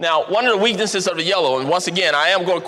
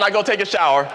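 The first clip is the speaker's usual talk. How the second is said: ordinary delivery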